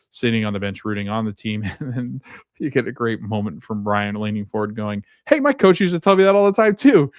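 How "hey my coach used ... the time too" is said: high, loud eager call-out. Retold or imitated. Friend leans forward at a soccer game to chime in, calling out. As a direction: pipe up loudly and high, acting out the quote.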